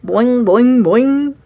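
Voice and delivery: loud springy boings; boing sounds. A narrator's reading. A frog hops in the car, bouncy boing.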